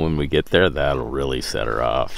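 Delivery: usual speaking voice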